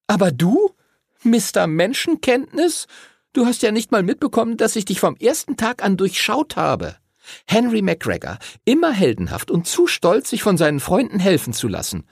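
high-pitched, mocking girl's tirade; Lucy, exasperated girl. Lucy tells Henry she sees through him, high exasperated voice. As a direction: throw it at him in a high child voice.

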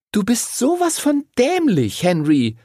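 put-on very high, exasperated girl; scolding. A narrator's reading. Lucy scolds Henry for his plan, high exasperated scolding. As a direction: scold in a high-pitched child's voice. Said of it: "schimpfte Lucy".

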